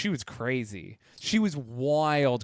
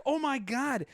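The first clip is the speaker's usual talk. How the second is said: shocked friend; 10 semitones higher than usual. Retold or imitated. Second friend seeing the fight, shocked. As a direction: imitate an aghast friend in a high voice.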